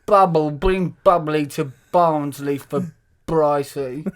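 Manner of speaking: put-on slow, drawn-out drunk pleading; drunk caller. Drunk woman begging Bubble for a lift, slow hammed-up slur. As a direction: play it drunk, labouring over every B word. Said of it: "She's drunk, Bubble".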